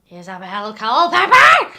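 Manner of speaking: loud, slow shout of the name; drawn-out shouted name; mock outraged shout. Real, not acted. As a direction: bellow the name like a curse. Names the hated teacher.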